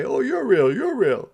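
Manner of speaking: high, loud gleeful repeat; excited. A narrator's reading. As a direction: bubble over with joy. Harold finds Santa.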